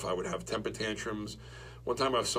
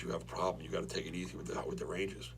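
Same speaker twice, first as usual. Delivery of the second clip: quiet father; 3 semitones lower, 7 dB quieter, faster than usual. Retold or imitated. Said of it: "He goes".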